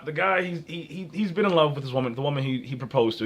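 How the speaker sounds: ordinary talk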